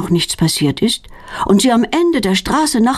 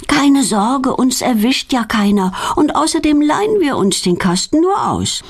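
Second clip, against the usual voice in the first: plain reading voice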